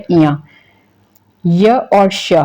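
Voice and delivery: ordinary talk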